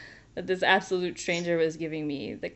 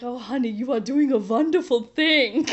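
a hint of a big comforting German tailor; gently soothing, a little higher; lightly voiced motherly tailor; 5 semitones higher, 4 dB louder than usual. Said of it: "She's like".

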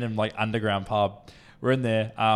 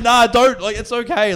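high, loud protest, acted out; mock alarm; 11 semitones higher, 10 dB louder than usual. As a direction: plead loudly in mock panic. Acted calming down of yelling man.

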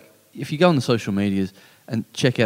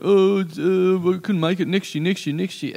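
higher, hemming and hawing imitation; mock excuse-making; 6 semitones higher than usual. Organiser mimicking people who couldn't make it, mock-apologetic.

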